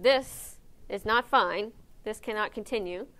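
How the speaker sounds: "not" hit loud and slow; firm declaration. Retold or imitated. Staff deciding the kennel must change, firm and emphatic. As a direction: lay down the law on "This is not fine", slowly. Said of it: "the first thing that we did was said".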